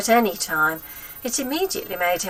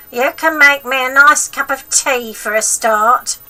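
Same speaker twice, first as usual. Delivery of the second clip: put-on high, loud cranky old woman; bossy wicked granny, pitched up and loud; grandma voice; 6 semitones higher, 10 dB louder than usual. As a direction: nag the boy like a spiteful old grandma.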